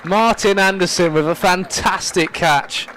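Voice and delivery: high, loud, thrilled play-by-play; excited commentary. Real, not acted. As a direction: celebrate the catch as it happens. Continuing the excited call of the catch.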